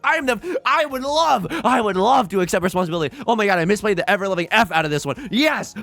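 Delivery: high, loud, fast frantic rambling; self-deprecating rant. Real, not acted. Streamer ranting about misplays to chat, frantic and high. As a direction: spiral into a panicked apology.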